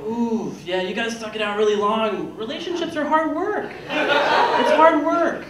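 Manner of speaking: high, sing-song mock sympathy, dragging out the last hard work; pretend-pity friend; fake-sympathetic friend. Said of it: "and they go".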